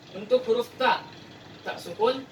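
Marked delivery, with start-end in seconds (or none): none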